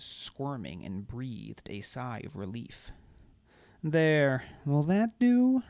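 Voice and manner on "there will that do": high, loud mocking coo. A narrator's reading. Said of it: "Marla cooed".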